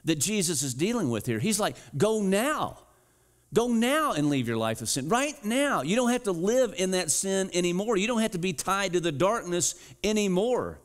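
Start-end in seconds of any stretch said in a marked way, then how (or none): 2.0-10.8 urgent plea. Acted. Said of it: "sense of urgency".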